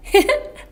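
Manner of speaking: a bright high giggle at a joke. Shown as a demonstration. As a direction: laugh up high as if tickled. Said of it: "tone of voice match the emotion".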